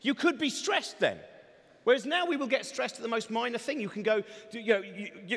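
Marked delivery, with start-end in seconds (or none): none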